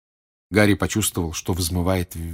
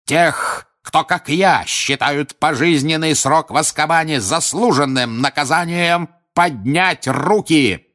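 slow, loud, higher bellow; shouting at full lung; 8 semitones higher, 4 dB louder than usual. A narrator's reading. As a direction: roar it to the jury. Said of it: "крикнул Крауч во всю силу лёгких".

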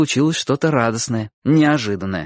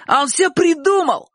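very high, drawn-out accusing cry; urgent shout. A narrator's reading. Shurik backs Bocho against Chik, shouting. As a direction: yell it shrilly as an accusation. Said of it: "крикнул Шурик".

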